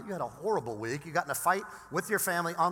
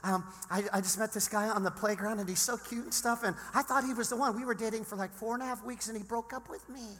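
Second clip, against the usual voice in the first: put-on sixth grade girl voice; 5 semitones higher than usual. Pastor mocking a sixth grade girl's breakup drama, girlish voice. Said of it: "let me put on my sixth grade girl voice".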